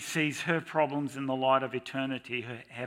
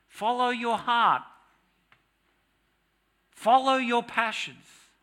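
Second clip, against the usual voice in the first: high, slow, mock-dreamy slogan; sarcastic mock-inspirational voice; voice of culture; 10 semitones higher, 5 dB louder, slower than usual. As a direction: parody the world's sweet advice, drawn out high. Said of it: "a culture that screams at us".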